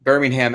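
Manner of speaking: "Birmingham" with a clear "ham" ending; Alabama pronunciation. Shown as a demonstration. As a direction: say "Birmingham" with the H fully sounded. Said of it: "that's pronounced that way".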